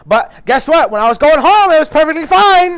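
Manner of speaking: loud, high exasperated yell; indignant outburst. Real, not acted. Ranting to friends about the train, indignant yell. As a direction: shout it, outraged at the irony.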